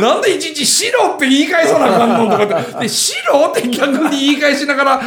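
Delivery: high, loud laughing outrage; mock indignant. Friend retelling the Yokohama clerk, mock angry and laughing. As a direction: act out her irritation through laughter. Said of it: "それにムカついたらしいねん".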